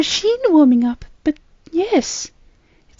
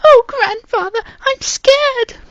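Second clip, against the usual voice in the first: high, crying fearful plea; whimpering for grandfather; frightened girl crying to her grandfather; scared; 13 dB louder, slower than usual. A narrator's reading. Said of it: "contorted in agony".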